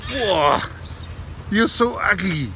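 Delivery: high, cheeky parrot squawk; a cheeky parrot teasing a woman, high and squawky. Retold or imitated. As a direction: rude parrot mocking a passerby. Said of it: "then the parrot says".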